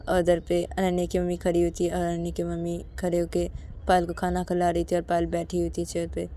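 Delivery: usual speaking voice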